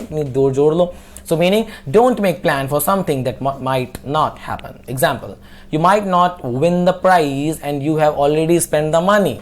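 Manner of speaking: normal talking voice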